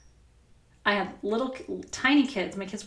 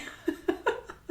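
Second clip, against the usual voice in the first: soft laugh; 3 dB quieter than usual. Real, not acted. Laughing at own tantrum.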